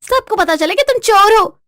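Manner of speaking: very high, loud outburst; shrieking indignant wife; defiant yelling. Acted. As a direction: shout so the neighbours hear. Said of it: "तुम ऐसे क्यों चिल्ला रही हो".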